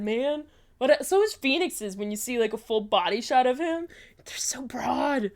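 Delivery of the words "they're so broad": soft, slow whisper; whispered awe. Real, not acted. Gamer marvelling at the character's shoulders, slow whisper. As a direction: breathe it out in awe. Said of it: "whispered admiration".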